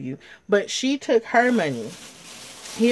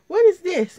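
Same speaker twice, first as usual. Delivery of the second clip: higher, louder mock-puzzled question; retold surprise; 11 semitones higher, 5 dB louder than usual. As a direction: act out being taken aback by a gift.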